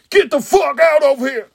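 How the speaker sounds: loud, high bark; angry mock shout. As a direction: shout them out of the room. Acted outburst at someone.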